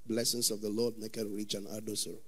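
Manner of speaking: the quiet voice inside the shouters; inner calm voice. Retold or imitated. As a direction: speak it quietly as the heart's voice. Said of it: "Inside of them, say".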